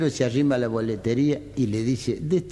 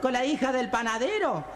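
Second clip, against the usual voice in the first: much higher gossiping camp voice; camp caricature; 3 dB quieter than usual. Acted. One camp friend tells the other his news, much higher voice.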